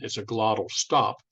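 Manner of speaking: ordinary talk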